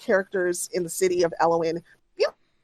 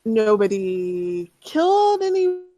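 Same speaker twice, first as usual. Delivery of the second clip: pleading sadly; 9 semitones higher, 3 dB louder, slower than usual. Acted. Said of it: "looks at the dog a little sadly".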